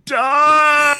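high, loud bark of a word; loud yell. Acted. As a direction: belt it out. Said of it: "you yell so loud that your mic cut out".

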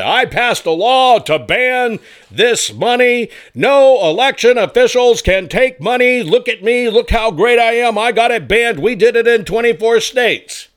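high, loud, boastful mock politician. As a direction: ham up a preening politician bragging. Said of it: "you can hear your politicians say".

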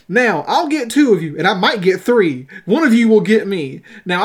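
storyteller acting an insistent speech; hammering on. A narrator's reading. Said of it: "Horton hammered on".

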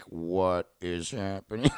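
hushed, slow mock bewilderment; quiet retold disbelief, drawn out; stunned onlookers. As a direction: imitate a baffled crowd, softly and slowly. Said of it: "everyone was like".

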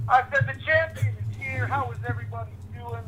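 distant voice over a phone speaker; talking from a phone on speaker; on speakerphone. Real, not acted. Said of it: "If you're on speaker".

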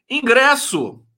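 high, loud punch on Ingresso; loud exclamation. Real, not acted. Host reading chat greetings, loudly exclaiming the word Ingresso.

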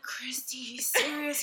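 soft hushed warning; urgent whisper. Retold or imitated. Sister warns Christy under her breath, hushed urgent whisper. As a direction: whisper it so the seller can't hear.

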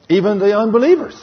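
preacher's loud emphatic cry. Acted. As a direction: throw it out to the congregation loudly. Emphatic sermon point.

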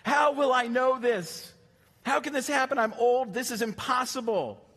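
put-on an old priest who can't believe it; how will I know, I'm old, it's impossible; incredulous old man. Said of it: "Let's not give him a hard time".

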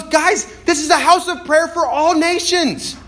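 high, loud, slow plea to the crowd; impassioned rebuke. Acted. Jesus to those blocking the Gentiles' prayer, impassioned rebuke. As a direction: cry out in indignation, drawing out the key words.

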